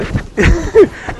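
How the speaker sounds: laugh. Real, not acted. Laughs at own joke.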